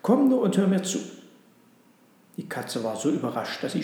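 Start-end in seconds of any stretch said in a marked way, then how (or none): 0.0-1.0 higher, louder coaxing call; eager raised summons; excited calling. A narrator's reading. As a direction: urge the cat over excitedly. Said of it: "rief der Hund aufgeregt".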